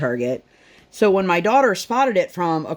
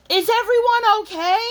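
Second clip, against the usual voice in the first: put-on high, louder child's take on the raspy neighbor; daughter's impression of the neighbor; 3 dB louder than usual. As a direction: squeak it out like a kid copying the neighbor. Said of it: "my daughter now does an amazing impression".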